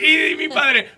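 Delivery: high, loud excited call; excited shout. Real, not acted. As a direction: cry it out with glee. Continues the excited outburst.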